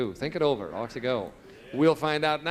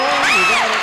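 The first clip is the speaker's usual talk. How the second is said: loud, high cry of triumph; excited shout; 12 dB louder, slower than usual. Real, not acted. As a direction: shout it out, thrilled. Host confirms a winning answer.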